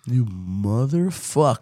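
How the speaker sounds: slow, drawn-out mock outrage; angry cat's voice. Owner voicing his cat finding no treats, slow mock anger. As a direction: draw out each word like an offended cat. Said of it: "he got so angry".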